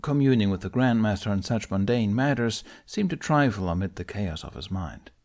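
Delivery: plain reading voice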